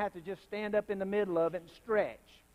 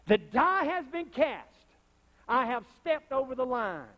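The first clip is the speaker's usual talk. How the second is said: high, loud, slow declaration; solemn vow; 6 semitones higher, 4 dB louder than usual. Real, not acted. Reading a martyr's vow, high and slow. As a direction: swear it like a martyr.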